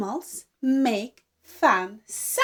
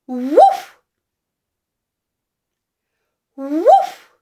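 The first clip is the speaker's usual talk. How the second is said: demo woof; 6 dB louder than usual. Shown as a demonstration. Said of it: "Dog says".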